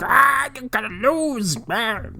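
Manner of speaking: high, slow leprechaun taunt; growling leprechaun taunt. Retold or imitated. Sheamus taunting on the court, high slow leprechaun growl. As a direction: drag out a gloating creature taunt. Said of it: "running and growling".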